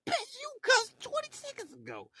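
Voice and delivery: high, cracking squeal of disbelief; incredulous squeal. Retold or imitated. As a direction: shriek it like you can't believe the hypocrisy.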